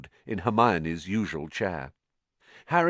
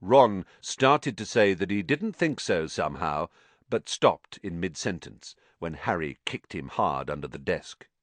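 plain reading voice

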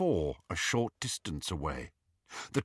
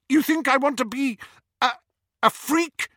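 scornful sister; 5 dB louder than usual. A narrator's reading. Petunia rejecting her sister on the platform, loud and scornful. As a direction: spit it out, much louder and higher.

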